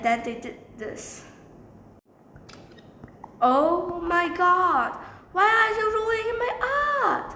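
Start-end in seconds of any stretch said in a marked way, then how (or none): 3.4-7.3 melodramatic mock outrage; outraged wail. Acted outcry over ruined art.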